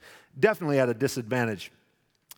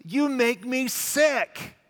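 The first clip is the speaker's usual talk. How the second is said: high, drawn-out disgust; 8 semitones higher, much slower than usual. Retold or imitated. Preacher voicing his disgust at Saul's hypocrisy. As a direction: spit out the disgust. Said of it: "It's like".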